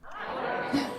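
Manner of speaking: ordinary talk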